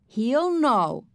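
lightly voiced young boy; little boy Fudge. A narrator's reading. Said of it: "Fudge said".

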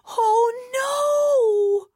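put-on very high, anguished child's cry; groaning dismay. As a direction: wail it like a girl who can't bear it. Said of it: "put her head back down and groaned".

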